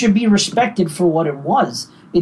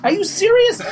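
surprised; 10 semitones higher than usual. Retold or imitated. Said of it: "I'm like".

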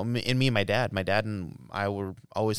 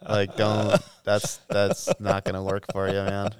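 lecturing advice; 9 dB louder than usual. Retold or imitated. Stranger lectures him on hunting.

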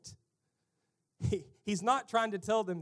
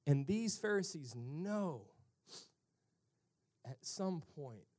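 ordinary delivery

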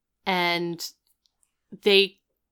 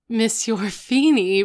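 hammy mock French accent, a bit louder; over-the-top French accent; 4 semitones higher, 6 dB louder than usual. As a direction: ham up a fake French accent on the name. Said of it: "like, over-the-top French accent".

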